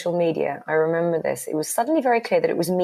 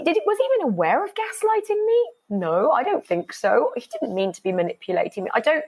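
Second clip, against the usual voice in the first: usual speaking voice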